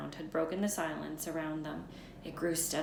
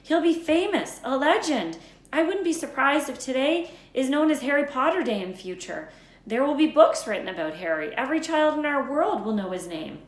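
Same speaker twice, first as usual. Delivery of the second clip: higher and louder, worked up; much louder indignant insistence; heated disbelief; 7 semitones higher, 9 dB louder than usual. A narrator's reading. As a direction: argue it out, growing heated. McGonagall argues against letter.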